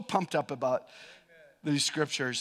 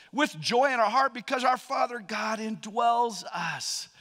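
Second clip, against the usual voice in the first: full-force preaching; 4 semitones higher, 4 dB louder than usual. Acted. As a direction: preach it out with conviction.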